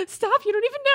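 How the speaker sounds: very high, loud squeal; mock overwhelmed. Viewer pleading with the tender dad on screen, high mock wail. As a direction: squeal it as if it's too much to bear. Viewer overcome by father's speech.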